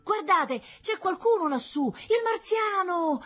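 very high, loud screams of alarm; alarmed shouting. A narrator's reading. Onlookers seeing a Martian kidnap Paolo, screaming. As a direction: cry out to the whole crowd.

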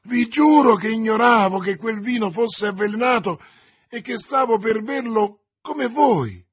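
loud, high, impassioned protest; desperate oath. A narrator's reading. D'Artagnan swears he knew nothing of the poison, desperate. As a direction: plead innocence at full urgency. Swears innocence over dying man.

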